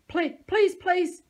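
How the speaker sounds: high, loud desperate pleading; terrified high begging. A narrator's reading. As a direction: beg for your life. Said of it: "he begged".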